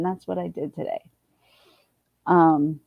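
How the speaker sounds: usual speaking voice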